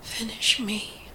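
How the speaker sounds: hushed, whispered plea; raw begging. A narrator's reading. Masked man begging his lover to finish him, in a raw whisper. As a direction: beg softly, with the voice stripped to breath. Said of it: "he begs, voice stripped to raw sinew".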